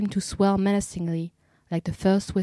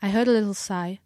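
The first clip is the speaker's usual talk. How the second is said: usual speaking voice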